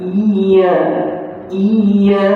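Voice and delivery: "Iya" with a long, pressed doubled y. Shown as a demonstration. Quran teacher models the required pressure, "iya" with heavy y. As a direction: lean on the y in "iya" and hold it. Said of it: "Tekanan, tekanan".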